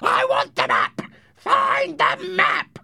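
harsh, raspy scream; furious rasping shout, words drawn out; Blind Pew. Acted. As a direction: scream the demand in a strained villain voice. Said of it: "Blind Pew screamed".

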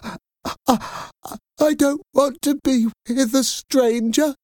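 put-on high, stammering child on the edge of tears; a little girl who starts to cry and begs. Girl pleads with her parents, crying and stammering. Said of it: "Natty started to cry".

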